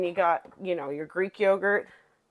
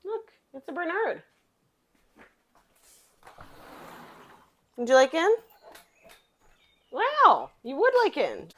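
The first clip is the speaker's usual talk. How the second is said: much higher pet-talk voice; talking to the dog; 10 semitones higher than usual. Real, not acted. Greeting the dog at the door, high and sweet.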